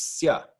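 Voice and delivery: "sia" as one syllable, "sya"; blended vowels. Shown as a demonstration. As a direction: glide the I straight into the A in "sia". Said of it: "blend together to form".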